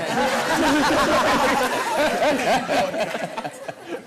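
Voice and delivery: loud high burst of laughter; big laugh. Real, not acted. Telling the barbershop story, loud laugh. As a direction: crack up at your own story.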